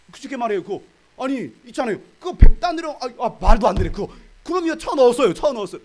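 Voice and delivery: high, loud, stammering outrage; so angry the words trip out; sputtering fury. Retold or imitated. Servant telling the king, sputtering and shouting. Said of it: "막 열받아, 막 말이 떠네".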